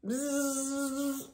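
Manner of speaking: a buzzing hum; bee buzz. Acted. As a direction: buzz like the bees. Bees buzzing.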